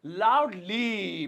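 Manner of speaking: "Loudly" very slowly, syllables stretched; drawn-out repetition. Shown as a demonstration. Teacher introducing a new adverb to students, drawn out.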